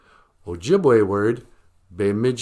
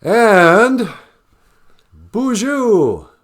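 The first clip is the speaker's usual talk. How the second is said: a much higher, louder showy bonjour; a hammed-up, much louder bonjour; hammed-up host greeting; 12 semitones higher, 11 dB louder, much slower than usual. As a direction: open the show like a grand French host. Mock show opening.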